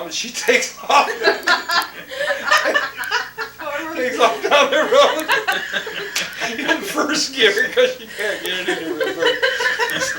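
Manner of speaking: high-pitched laughter through the words; can barely get the words out for laughing; laughing hard. Real, not acted. Laughing hard while telling family the punchline.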